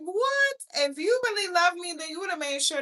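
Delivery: high, loud, indignant comeback; indignant retort. Retold or imitated. Telling off the ex-husband over his love claim, high and indignant. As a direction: snap back at him, offended. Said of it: "I'm like".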